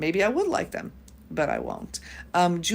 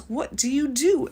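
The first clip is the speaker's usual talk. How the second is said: higher, slow mock English accent; horrible English accent; 6 semitones higher than usual. London kid asking the traveler about her work, horrible English accent. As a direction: imitate a curious English kid, badly. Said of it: "bring out a horrible English accent".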